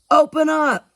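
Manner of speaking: loud call, high and pushing; calling through a door. Acted. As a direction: shout through the closed door.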